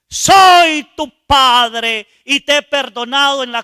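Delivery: very loud, high and slow proclamation; the Father proclaiming pardon to his son; booming voice of God. Retold or imitated. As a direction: thunder the forgiveness down. The Father answers with forgiveness.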